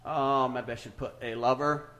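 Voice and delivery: usual speaking voice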